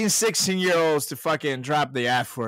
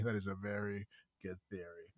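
quiet echo; 6 semitones lower, 14 dB quieter than usual. Shown as a demonstration.

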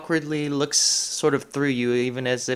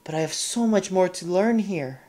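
dreamy sigh; 4 semitones higher, 3 dB louder than usual. Acted. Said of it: "as if she's striking a ballet pose".

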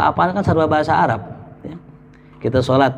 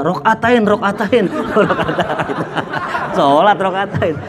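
laughing punchline; 4 semitones higher than usual. Pretend.

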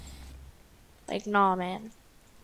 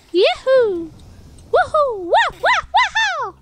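cartoon whooping; 4 dB louder than usual. Cartoonish whooping while playing.

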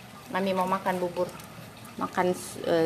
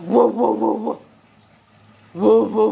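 loud punchy woofs; playful mock dog bark; imitated barking; 10 dB louder than usual. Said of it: "Super bazoke, super duper suaranya".

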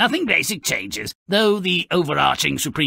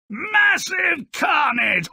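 gleeful shout; 13 semitones higher, slower than usual. Acted. Host relishes the battle.

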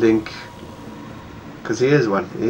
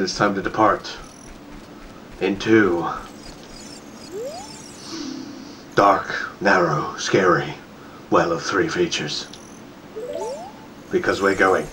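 spooky narration; 4 semitones lower, 6 dB quieter than usual. Pretend. Streamer introducing the scary well, mock spooky whisper. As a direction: sink into a mock horror-trailer voice.

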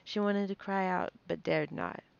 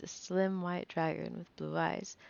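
plain reading voice

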